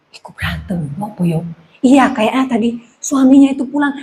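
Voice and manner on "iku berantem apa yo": hushed, low nosy murmur; secretive low gossip voice; eavesdropping neighbor. Acted. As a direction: drop very low and soft, as if listening in.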